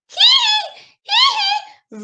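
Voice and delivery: very high squeaky pig giggle; squealing pig laugh. Acted. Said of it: "Pig went up to Dog and went".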